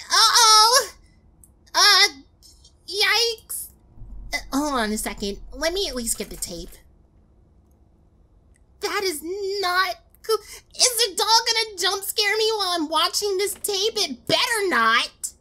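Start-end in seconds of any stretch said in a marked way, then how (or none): 0.1-3.7 scared horror game streamer. Real, not acted. Streamer frightened. 8.8-15.3 scared horror game streamer. Real, not acted. Dreading a jump scare.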